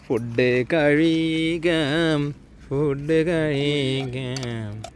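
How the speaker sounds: slow sing-song food call; drawn out. Real, not acted. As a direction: stretch each word out slowly.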